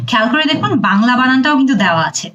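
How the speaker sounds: ordinary delivery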